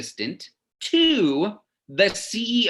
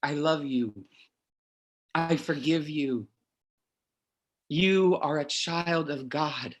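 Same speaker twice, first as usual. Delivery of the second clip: Mom's gentle forgiveness; 3 dB quieter than usual. Retold or imitated. Son voicing Mom's imagined forgiveness, softly. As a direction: soothe him as a loving mother. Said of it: "That's what you will say".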